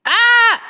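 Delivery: high, loud shriek; sudden scream. Real, not acted. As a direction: burst out in a sharp high scream. Sudden outburst after reading message.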